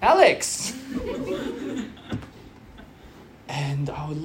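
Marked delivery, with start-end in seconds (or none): 0.0-0.8 mock call. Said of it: "she'd be like". 0.8-1.9 a sudden high burst of laughter; high-pitched delighted laugh. Real, not acted. Laughing at daughter's cheekiness.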